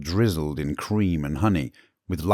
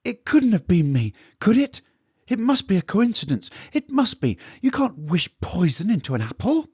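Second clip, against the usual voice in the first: panicked cook; 14 semitones higher than usual. Acted. Stefan panicking over blame.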